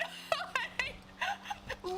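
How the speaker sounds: high laugh with a cry in it. Real, not acted. Laughing at the memory, a high crying laugh.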